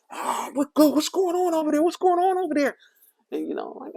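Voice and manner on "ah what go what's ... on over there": much higher, loud imitated yell from passing cars; people yelling. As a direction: call out like a stunned passer-by across the street.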